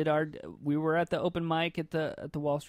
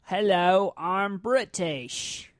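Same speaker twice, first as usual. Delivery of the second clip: loud, stretched-out fake British accent; bad British accent; 3 semitones higher, 5 dB louder, much slower than usual. Shown as a demonstration. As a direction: slowly overdo a terrible British accent. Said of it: "Let me do my British accent".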